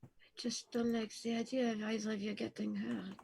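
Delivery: hushed, almost whispered worry; worried murmur. Acted. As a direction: voice sinking to a soft whisper. Worried about friends being tortured.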